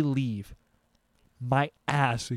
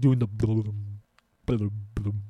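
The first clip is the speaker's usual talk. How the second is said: stomach rumble imitation; 5 semitones lower, 4 dB quieter than usual.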